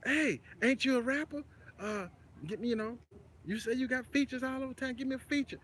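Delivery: retold stranger in a high, put-on non-Texas accent, Louisiana-like; a stranger's Louisiana-style accent, imitated high and light; mock out-of-town accent. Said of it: "the guy's accent was different".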